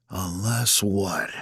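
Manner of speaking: slow, soft reply; softly challenging; guarded antagonist. A narrator's reading. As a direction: answer quietly, drawn out.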